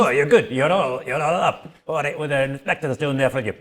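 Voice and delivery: Haggis. Acted. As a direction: call out heartily as the messenger. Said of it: "Haggis, um, appears".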